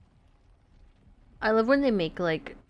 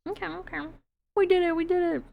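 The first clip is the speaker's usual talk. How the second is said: high, gleeful victory squeal; shrieking jackpot winner; 10 semitones higher, faster than usual. Real, not acted. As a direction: squeal with joy over the win. Celebrating the win.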